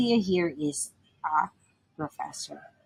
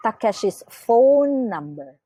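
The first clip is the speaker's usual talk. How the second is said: Takeshi's phone number with phone held long and louder; stress on phone; 3 semitones higher, 5 dB louder than usual. Shown as a demonstration. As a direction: hit the word phone and stretch it. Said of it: "give emphasis on the second noun".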